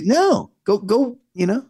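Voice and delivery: high-pitched, emphatic brush-off; dismissive refusal. Acted. Acting out refusal of imposed belief.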